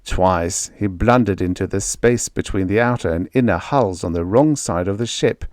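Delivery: plain reading voice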